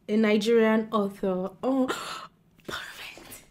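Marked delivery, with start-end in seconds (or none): 1.6-3.4 gasp, then a soft breathy whisper; delighted whisper. Real, not acted. Finding a Nigerian author for the prompt, she whispers delightedly. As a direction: hush it like a delighted secret.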